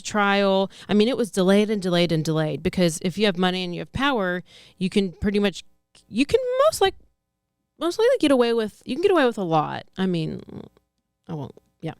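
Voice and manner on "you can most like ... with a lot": excited. Real, not acted. Excited rant about the rich.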